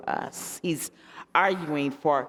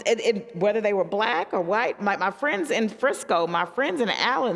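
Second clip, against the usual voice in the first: normal talking voice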